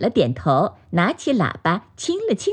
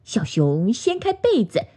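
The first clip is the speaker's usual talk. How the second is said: usual speaking voice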